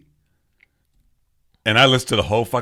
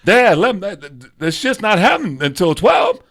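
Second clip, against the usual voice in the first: put-on whiny teen son; 10 semitones higher, 5 dB louder than usual. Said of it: "this whole skit".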